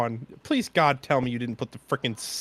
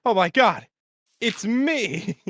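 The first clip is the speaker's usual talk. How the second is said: mock amazed gasp; 9 semitones higher, 3 dB louder, slower than usual. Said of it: "And it's like".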